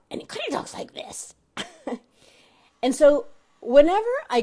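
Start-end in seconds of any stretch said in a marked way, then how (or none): 0.1-2.0 high, breathy cartoon dog voice; squeaky Scooby-Doo impression. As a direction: talk like the cartoon dog, pitched way up. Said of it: "Scooby-Doo is this dog".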